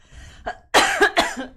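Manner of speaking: loud throat-clearing cough; real cough. Real, not acted. Host whose voice is going, real cough. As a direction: cough hard, throat giving out. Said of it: "my voice is gonna go".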